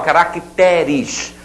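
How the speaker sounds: "caracteres" stressed on TE, with open E; slow demo of "caracteres", ca-rac-TÉ-res. Shown as a demonstration. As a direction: lean on the TE in "caracteres". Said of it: "A sílaba tônica passou do ra para té".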